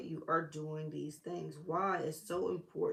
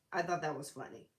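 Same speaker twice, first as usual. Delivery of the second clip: ordinary talk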